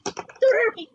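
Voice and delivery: high, small pleading voice; hammed-up meek plea; mock frightened. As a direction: plead like a scared kid. Students scared of coach.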